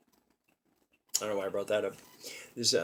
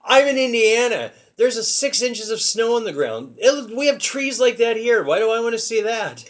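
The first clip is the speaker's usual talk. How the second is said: imitating mom, higher and louder; mom's mock rant; 9 semitones higher, 7 dB louder than usual. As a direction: play up mom's exasperated complaint, high and loud. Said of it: "She said".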